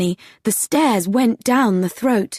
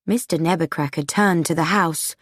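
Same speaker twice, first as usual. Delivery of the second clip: ordinary delivery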